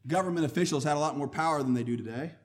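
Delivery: ordinary delivery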